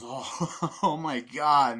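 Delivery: loud, slow laughing delight; acted sadistic glee; gleeful laughing killer. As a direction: laugh through it, savouring the thrill. Acting gleeful killer after the violence.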